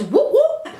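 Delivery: high mock siren whoop; police siren mimicked by mouth; imitated police siren. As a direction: whoop like a cop car siren. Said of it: "all you hear is".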